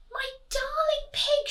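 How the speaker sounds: high, sweet, posh-lady voice; doting grand lady. Acted. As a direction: coo at the pig. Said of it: "she said".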